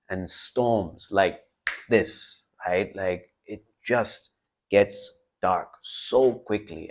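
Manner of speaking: ordinary talk, nothing marked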